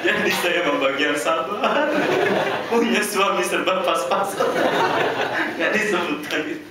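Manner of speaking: high, loud playful jab at husband; loud joking. Real, not acted. Wife teasing her husband before the guests, loud and laughing. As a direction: tease him loudly with a laugh in the voice. Wife's joking reply at event.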